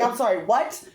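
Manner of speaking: principal's question through laughter; laughing. Retold or imitated. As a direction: ask it while cracking up. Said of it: "He's like".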